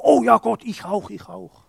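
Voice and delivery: eager volunteering. Retold or imitated. Mocking an overeager believer.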